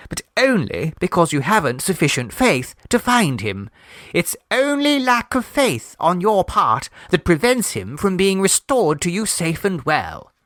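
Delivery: a hint of a prim believer lecturing; earnest believer. A narrator's reading. Miss Gilpert lectures Mrs Mummerby on faith, lightly prim.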